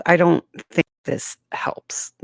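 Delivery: whispered close to the mic; right in your ear. Shown as a demonstration. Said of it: "would you like it if I talked right in your ear like this".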